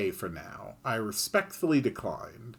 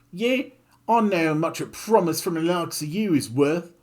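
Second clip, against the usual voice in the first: high, louder sneer; scornful Kid; 7 semitones higher, 6 dB louder than usual. Acted. Kid sneering at the griffin's promise, scornful. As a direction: dismiss the promise with contempt.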